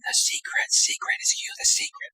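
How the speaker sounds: hushed, voiceless whisper from a movie; imitated whisper. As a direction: whisper it softly like a movie secret. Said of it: "We couldn't stop whispering".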